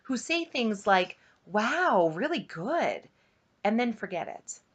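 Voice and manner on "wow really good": dutiful praise. A narrator's reading. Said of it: "dutifully admired by friends".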